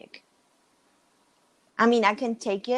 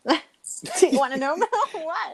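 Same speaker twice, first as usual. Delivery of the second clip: laughing; 8 semitones higher, 3 dB louder than usual. Real, not acted. Laughs while asking.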